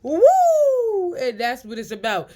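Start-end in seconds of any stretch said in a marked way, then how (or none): 0.0-1.1 loud, very high "woo" out of laughter; laughing whoop. Real, not acted. Relishing the laugh at energy drainers, high whoop.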